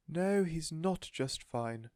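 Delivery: high, very slow protest; protesting cry. A narrator's reading. Missus Silver protesting to Mister Hoppy, plaintive. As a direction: plead for the tortoise. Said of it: "Missus Silver cried".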